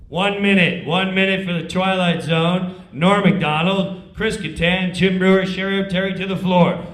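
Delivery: loud cue call; stage manager calling. Retold or imitated. Stage manager calling cast to the floor, loud.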